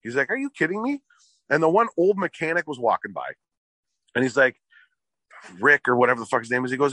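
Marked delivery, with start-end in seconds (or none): 0.3-1.0 pitched way up in disbelief; a car salesman can't believe it; stunned disbelief. Retold or imitated. Salesman to customer, high disbelieving cry.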